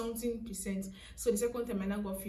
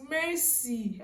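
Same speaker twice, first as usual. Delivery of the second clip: usual speaking voice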